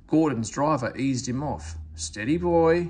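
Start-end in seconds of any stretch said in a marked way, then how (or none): 2.0-2.9 louder call to steady him. A narrator's reading. Driver calling to Gordon to ease off, louder. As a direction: call out to calm the engine. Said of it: "he said".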